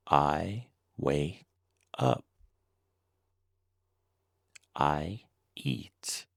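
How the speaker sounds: ordinary delivery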